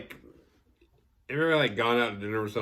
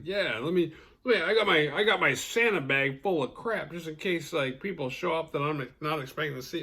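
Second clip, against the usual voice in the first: hammed-up eager voice, higher; higher, excited gift-giver; 6 semitones higher than usual. Admiral's son telling a girl about his gift bag, hammed-up and higher. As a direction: sound thrilled to have a gift ready.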